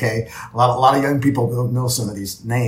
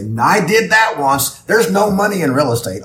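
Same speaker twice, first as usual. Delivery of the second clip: mock quitter's complaint; 7 semitones higher, 7 dB louder than usual. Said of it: "will stop and quit and say".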